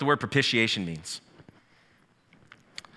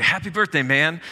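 bright burst, higher and louder; upbeat greeting; 5 semitones higher, 5 dB louder than usual. Acted. Pastor reading a sample birthday card, upbeat and loud. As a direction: cheer it out like a birthday shout.

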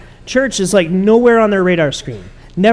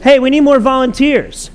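louder and higher, a hammed-up call for helpers; mock church announcement; 7 semitones higher, 6 dB louder than usual. Pastor teasing his own upcoming appeal, loud and mock-eager. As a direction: play the eager pastor begging for volunteers. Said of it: "I'm gonna say that in a few minutes".

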